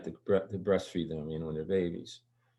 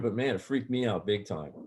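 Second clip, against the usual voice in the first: normal talking voice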